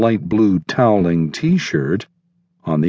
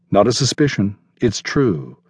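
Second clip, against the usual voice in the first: plain reading voice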